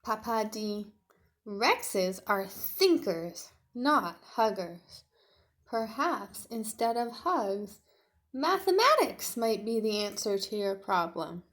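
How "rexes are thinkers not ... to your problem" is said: loud, slow lecturing dad; a hint of a know-it-all father; stern storybook father, steady; lightly voiced father.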